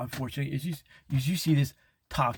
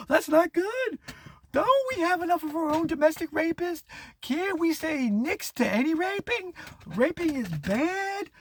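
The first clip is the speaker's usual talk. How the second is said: hammed-up objection; 13 semitones higher than usual. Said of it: "the rest of us are going".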